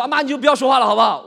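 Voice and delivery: loud, high-pitched cut-off; exasperated son talking over his mother; impatient. Acted. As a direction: shut mom down in frustration.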